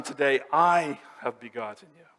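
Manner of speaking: emphatic, weighty "I" in a quoted declaration; stress on I. Acted. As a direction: lean hard on "I", then let the rest fall. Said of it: "I here is emphatic when he says".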